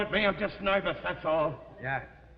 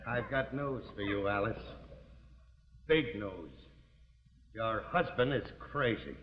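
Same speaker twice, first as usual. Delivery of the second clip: low, soft, ominous news; grim announcement; 11 semitones lower, 7 dB quieter than usual. Acted. Husband breaks bad news to wife, grim. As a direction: drop down low and break it gravely.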